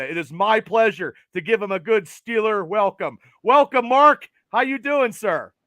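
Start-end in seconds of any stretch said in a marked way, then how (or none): none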